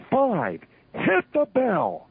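high, slow shouted call to the timekeeper; calling the bell. Retold or imitated. Referee calling to the timekeeper, slow high shout.

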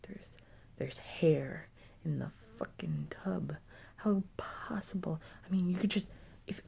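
hushed, voiceless venting; whisper. Real, not acted. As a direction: whisper it so the roommates won't hear. Venting about messy roommates, hushed.

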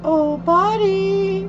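high, slow drawn-out call; falsetto. Acted. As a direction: sing out the word. Searching for own body.

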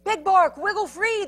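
high, loud urging; shouting. A narrator's reading. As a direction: shout up to someone in danger. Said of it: "the prairie dog shouted".

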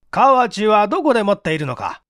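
stern interrogator. Acted. Chief interrogates.

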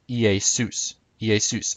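Iesus said ee-ay-SOOS; biblical Greek pronunciation. Shown as a demonstration. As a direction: say Iesus as ee-ay-SOOS, accent on the end. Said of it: "in biblical Greek".

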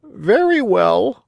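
very high, slow bird voice; agreeing pigeon. Acted. Pigeon agreeing to carry the puppet, high and slow.

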